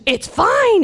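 high-pitched, delighted exclamation; imitating excitement. Deli worker answering about her neck, high excited imitation. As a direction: let it leap up in acted joy. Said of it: "So she was so excited".

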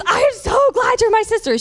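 very high, gushing mock shout; imitating excitement. Deli worker embracing a fellow believer, high excited imitation. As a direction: squeal it out as an overjoyed friend. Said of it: "So she was so excited".

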